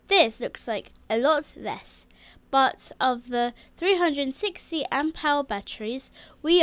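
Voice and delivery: loud, energetic how-to presenting; presenter voice. Acted. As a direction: present the install to camera, punchy. Presenting the battery install.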